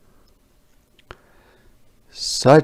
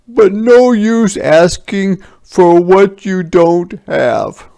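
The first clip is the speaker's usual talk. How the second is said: soldiers' voice; 9 semitones higher, 10 dB louder than usual. A narrator's reading. Soldier hinting to villagers for cabbage, higher and louder. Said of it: "said the soldiers".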